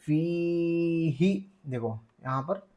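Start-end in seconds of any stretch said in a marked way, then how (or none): 0.1-1.3 फी held long, ही cut short; long-short contrast. Shown as a demonstration. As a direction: stretch फी, don't stretch ही. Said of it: "इसको नहीं खींचेंगे". (फी लंबा, ही बिना खींचे)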